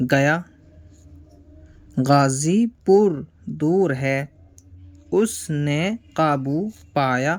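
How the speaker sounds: slow, clear dictation pace; slow lesson reading. Shown as a demonstration. Teacher reading the practice text to pupils, slow and deliberate. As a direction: space the words for beginners. Reads practice sentences slowly.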